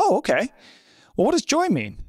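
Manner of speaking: high-pitched naive questioning; mock puzzlement. Reader looking up happiness in the dictionary, high and puzzled.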